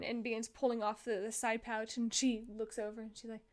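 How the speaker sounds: ordinary talk, nothing marked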